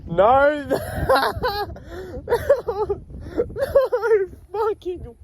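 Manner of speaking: high laughing wail of disbelief; half crying, half laughing. Real, not acted. Finds car pranked again.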